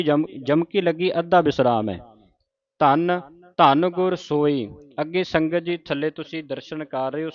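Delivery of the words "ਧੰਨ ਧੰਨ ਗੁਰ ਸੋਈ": slow, brief hold on the second ਧੰਨ; short stop on the second ਧੰਨ; half pause on second ਧੰਨ. Shown as a demonstration. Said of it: "ਮਾਮੂਲੀ ਜਿਹਾ ਰੁਕਣਾ ਹੈ".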